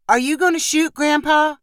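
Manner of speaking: put-on high-pitched boy asking his grandpa; young grandson. A narrator's reading. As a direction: ask eagerly in a high child's voice.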